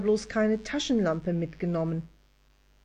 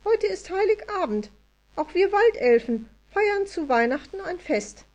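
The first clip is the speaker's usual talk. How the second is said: much higher, louder fairy voice; tiny elf voice; 12 semitones higher, 4 dB louder than usual. A narrator's reading. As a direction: tell it as a proud little elf. Elf talks about Christmas.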